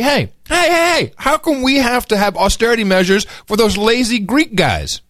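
high, whiny complaining voice; whining about lazy neighbours; indignant European worker. Retold or imitated. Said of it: "they're saying this, like".

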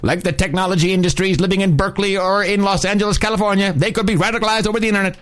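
high, fast newsreel announcer; old newsreel. Retold or imitated. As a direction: pump it out like old-time radio. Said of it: "old-time, uh, movie newsreel announcers".

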